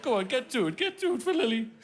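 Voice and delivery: strained high urging to himself; self pep talk. Retold or imitated. Dad coaxing himself through the book, voice straining high. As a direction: choke it back and push on. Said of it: "I'm like".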